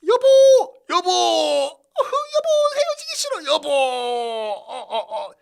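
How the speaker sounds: mock sobbing cries of 여보, very high and loud; hammed-up wailing wife. Wife forced to divorce wails for her husband, mock sobbing. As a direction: ham up a wife crying as she's dragged from her husband.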